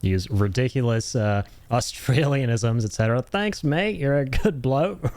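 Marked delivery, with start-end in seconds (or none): 3.2-5.2 hammed-up Australian accent, higher, slow on the bloke; over-the-top Australian accent; mock Australian accent. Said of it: "if they're gonna use this accent".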